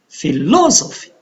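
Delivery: "Philosophy" with LO stressed, then falling; phi-LO-so-phy, weight on LO in "Philosophy"; stress on second syllable. Shown as a demonstration. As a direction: punch the LO in "Philosophy". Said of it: "it is on the second".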